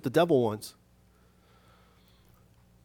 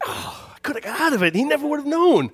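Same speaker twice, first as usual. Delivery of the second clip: high, hammed-up lament, rising louder; mock anguished regret; 11 semitones higher, 3 dB louder than usual. Preacher realizing he could have got out of it, mock wail. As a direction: wail it with comic exasperation. Acted regret at needless confession.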